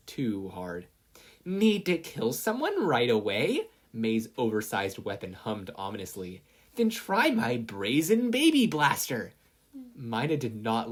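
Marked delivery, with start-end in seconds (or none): 1.4-3.7 high, loud, slow infomercial; menacing ad pitch. Acted. Mei advertising her blaster at Mina, menacingly. 6.8-9.3 high, loud, slow infomercial; menacing ad pitch. Acted. Mei advertising her blaster at Mina, menacingly.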